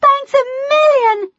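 high, loud, overjoyed outburst; cried out. Acted. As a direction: burst out thanks, near squealing. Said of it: "cried Harmony".

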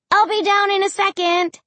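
high, sustained call. A narrator's reading. Child to parents from the stairs, calling out. Child calls while running upstairs.

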